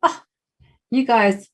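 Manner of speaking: ordinary delivery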